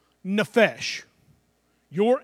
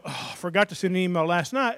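normal talking voice

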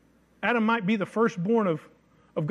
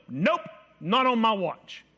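firm resolve; 4 semitones higher, slower than usual. Retold or imitated. Voicing healthy anger.

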